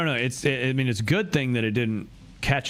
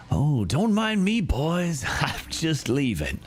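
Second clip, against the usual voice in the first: higher, taunting sing-song with a laugh; teasing Isabel; 5 semitones higher, slower than usual. Acted. Isabel taunts the gangsters on the call, teasing with a laugh. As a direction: tease the gang as you slip away. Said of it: "Oh, it's Isabel".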